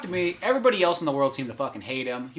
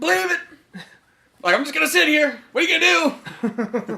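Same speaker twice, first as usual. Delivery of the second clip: hammed-up outburst at a high pitch; mock outburst; 11 semitones higher than usual. Mock re-enactment of movie outburst.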